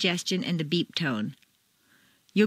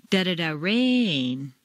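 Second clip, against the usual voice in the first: slow, rain on two notes; slow, "rain" musical over two notes; 3 semitones lower, slower than usual. Shown as a demonstration. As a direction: drawl the da-da-da, then sing rain. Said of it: "Make rain very musical".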